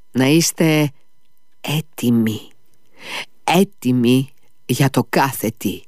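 έτοιμοι για το κάθε τι with each syllable stressed; every syllable of έτοιμοι για το κάθε τι leaned on. A narrator's reading. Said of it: "πρόφερε τονίζοντας την κάθε συλλαβή".

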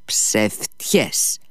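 a curt, firm muttered "lies"; sudden firm denial. A narrator's reading. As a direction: cut him off flatly. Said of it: "είπε ξαφνικά και σταθερά".

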